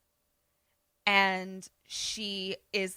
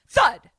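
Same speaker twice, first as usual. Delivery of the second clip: loud, high, abrupt sound effect; startling imitated thud; sudden thud; 8 semitones higher, 11 dB louder than usual. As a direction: punch it out like a bang. Voices sudden knock in story.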